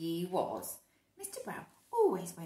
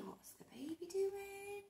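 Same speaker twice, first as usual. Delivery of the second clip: slow hushed question; whisper; 11 semitones higher, 9 dB quieter, slower than usual. Real, not acted. Reader whispering to the child about the baby. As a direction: whisper it like a secret.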